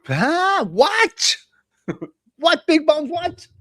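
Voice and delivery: high incredulous "what"; surprised what. Real, not acted. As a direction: react as if you can't believe it. Reacting to Big Bones' comment.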